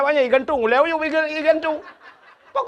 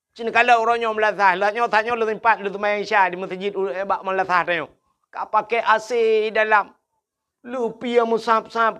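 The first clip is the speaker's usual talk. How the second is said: ordinary delivery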